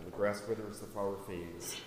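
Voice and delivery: a quiet, solemn verse; scripture reading. Acted. Preacher quoting Isaiah to the church, low and solemn. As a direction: read it softly as a closing verse. Short verse read aloud.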